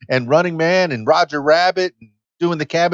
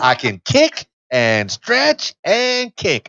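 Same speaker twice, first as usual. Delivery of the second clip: higher, put-on SNL sketch voice; Molly Shannon character; 6 semitones higher, much slower than usual. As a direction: play the proud fifty-year-old sketch lady. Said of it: "likened myself to the character that Molly Shannon did on SNL".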